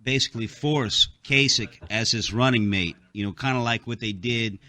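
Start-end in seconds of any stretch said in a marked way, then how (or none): none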